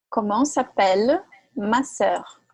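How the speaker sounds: "Comment s'appelle ma sœur?" spoken slowly, with "ma" stressed; slow drill question; teacher models "Comment s'appelle ma sœur?" slowly. Shown as a demonstration. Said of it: "Pronome possessivo minha".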